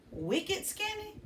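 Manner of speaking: "Wicked skinny?" asked high and slow, puzzled; puzzled question. Shown as a demonstration. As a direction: wonder aloud at "Wicked skinny?". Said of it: "I wonder what that means".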